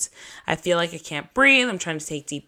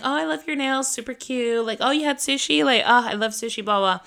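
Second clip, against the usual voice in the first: imitated small talk in a higher voice; chatty distracting nurse; 6 semitones higher than usual. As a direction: gush lightly, pitched up, to distract a patient. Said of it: "she tries to distract me".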